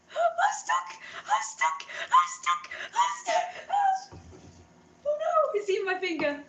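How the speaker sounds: very high squeaky mock cries; playful high-pitched "I'm stuck!". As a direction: squeal it like a trapped cartoon critter. Hand stuck in pocket, squeals.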